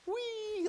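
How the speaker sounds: high-pitched gleeful "whee" squeal; mock joyride squeal; carefree whee. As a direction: sail up high as if sliding down. Imitates a smooth carefree drop.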